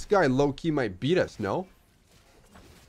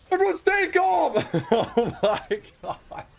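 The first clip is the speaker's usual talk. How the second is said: high-pitched cry breaking into laughter; giddy panic. Real, not acted. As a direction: shout it high, then laugh helplessly. Overexcited, tells everyone to stay calm.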